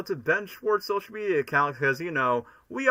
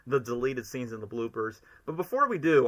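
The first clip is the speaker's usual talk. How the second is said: ordinary delivery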